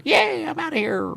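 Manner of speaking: very high, slow put-on cheer; gleeful departing voice. A soul who never murdered, leaving in high glee. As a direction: sing out a high happy goodbye.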